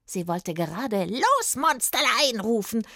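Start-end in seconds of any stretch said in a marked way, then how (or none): 1.0-2.4 high witch call, starting highest; gleeful, high-pitched hag's order; commanding witch. A narrator's reading. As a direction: call the pet monster to attack. Witch sets monster on Ludwig.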